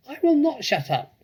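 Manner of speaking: very high, loud shriek; shrieking rat. A narrator's reading. Rat shrieks at the fox to go away. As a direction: screech it like a furious rat. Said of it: "shrieked Rat".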